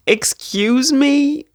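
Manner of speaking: slow, high incredulous "Excuse me?"; mock outrage. Real, not acted. To viewers, gasping at the gorgeous cover, incredulous. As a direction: draw it out, scandalised and delighted.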